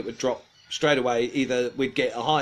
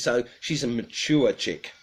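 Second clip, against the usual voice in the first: normal talking voice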